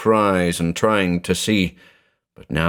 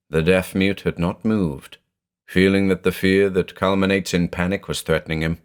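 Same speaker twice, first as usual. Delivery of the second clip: usual speaking voice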